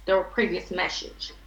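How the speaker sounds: ordinary talk, nothing marked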